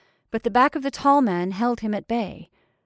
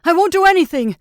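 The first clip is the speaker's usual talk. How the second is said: high, shouted tantrum; raging; 8 semitones higher, 10 dB louder, faster than usual. A narrator's reading. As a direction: rage back defiantly at full voice. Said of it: "raged the freckled man".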